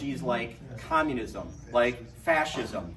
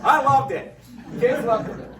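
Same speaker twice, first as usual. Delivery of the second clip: normal talking voice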